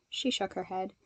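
ordinary delivery, nothing marked

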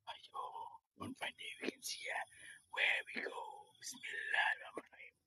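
soft, breathy whispered greeting. Real, not acted. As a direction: hush it so nobody hears. Whispering near people meditating.

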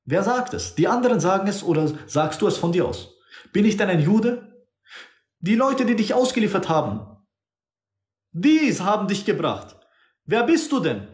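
high, probing interrogation voice; voicing Pilate. Preacher acting Pilate interrogating Jesus, high pitch. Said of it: "Pilatus hat gesagt".

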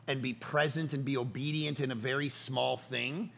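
normal talking voice

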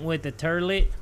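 "turlet", goofy r-coloured first syllable; toilet as "turlet". Pretend. Gamer reporting on the bathroom, playfully mispronounced "turlet". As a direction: end on "turlet" with an er vowel, lifting slightly.

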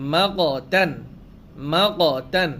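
ordinary talk

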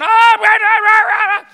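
imitating locals yelling in panic, high and loud; mock alarm cries from the crew and locals; panicked yelling. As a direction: burst into a frantic crowd's wail. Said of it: "all these locals start going".

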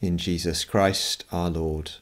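ordinary talk, nothing marked

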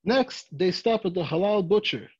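usual speaking voice

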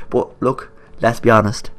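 usual speaking voice

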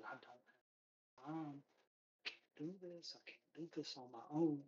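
panicked recruiter muttering under his breath; nervous. Acted. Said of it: "He looks really nervous".

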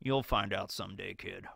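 low fading prison murmur; Morgan ominous. Retold or imitated. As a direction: murmur it alone in the dark cell. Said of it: "he's just like".

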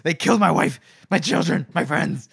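imitating a grieving man lashing out; recapping Logan, snapping at the jester; snapping in anger. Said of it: "Logan snaps".

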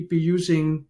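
usual speaking voice

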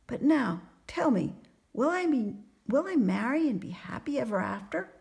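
high-pitched, hopeful questioning; eager young carpenter, excited. A narrator's reading. Carpenter asks fortune teller about marriage, eager excitement. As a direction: ask as if bursting to know the future.

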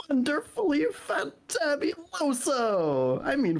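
very high, slow gushing cry; flamboyant squeal. Acted. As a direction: trill it out with delight. Flamboyant exclamation.

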